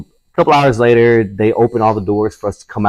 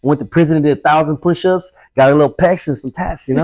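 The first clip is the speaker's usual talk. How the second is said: higher-pitched, hammed-up swagger of a show-off inmate; mock tough guy bragging; 6 semitones higher than usual. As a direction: play the boastful ex-con for laughs, pitched up. Mocking bragging inmates after prison.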